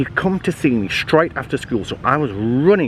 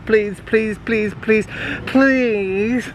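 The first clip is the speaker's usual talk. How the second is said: high, pleading stream of pleases; pleading child; 7 semitones higher, slower than usual. A narrator's reading. Boy begging his parents for a pet, high and pleading. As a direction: beg on and on in one breath like a desperate kid.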